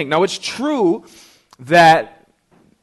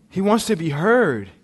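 ordinary talk

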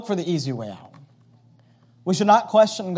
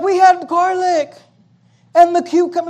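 grumbling Israelites; 9 semitones higher than usual. Retold or imitated. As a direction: mimic people pining for garlic. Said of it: "They're like".

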